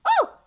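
very high, loud imitated yelp; sudden high mock scream of fright; puppy-like yelp. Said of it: "like a puppy".

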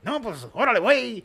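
loud, high-pitched Mexican caricature; mock Mexican accent. Shown as a demonstration. Improviser showing co-host his Mexican, hammed-up accent. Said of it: "yo hago mexicano".

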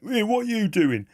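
hammed-up outraged fan; mock angry yell. Rival fans turning round on the cheering lads, mock angry yell. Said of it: "blokes turning around going".

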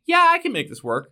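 high, loud smug idiot voice; dumb writer voice. Retold or imitated. Mocking a clueless article writer's smug boast. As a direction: play the potato-headed writer pleased with himself. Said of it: "and said".

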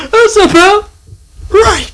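high squeaky laugh-cry; laughing hard. Real, not acted. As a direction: crack up while talking. Laughing repeat.

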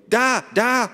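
very high, loud exclamation; sudden excited cry. A narrator's reading. Ada spots something in the ceiling, excited cry. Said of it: "rief sie plötzlich".

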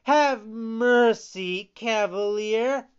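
high, loud begging; pleading. A narrator's reading. Pinocchio trying another title on the showman, high pleading. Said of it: "Then he pleaded".

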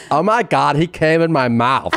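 mock girl-speak gossip; mimicking young women. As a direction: overshare in exaggerated girly vowels. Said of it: "That is what they sound like".

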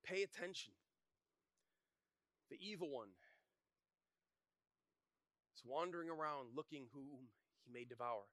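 usual speaking voice

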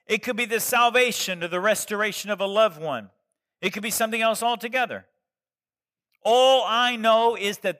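loud, high fervent preaching. Acted. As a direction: preach it with full conviction. Preaching builds to full force.

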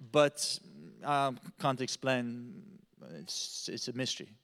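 put-on slow, low, halting German accent; lost for words, German accent; frustrated German accent. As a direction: trail into a baffled German-accented murmur. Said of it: "He's frustrated".

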